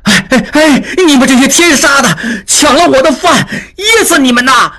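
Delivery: high, frantic cursing shout. A narrator's reading. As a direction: yell curses after fleeing thieves. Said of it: "茂才大喊着追了几步".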